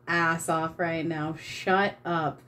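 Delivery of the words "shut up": ordinary delivery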